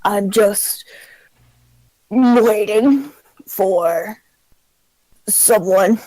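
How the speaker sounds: put-on slow, hesitant old man; lightly voiced anxious old man. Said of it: "old person voice".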